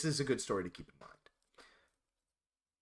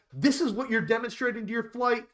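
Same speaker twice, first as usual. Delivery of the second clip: scolding; 9 semitones higher, 5 dB louder than usual. Retold or imitated. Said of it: "he goes something along the lines".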